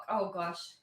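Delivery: normal talking voice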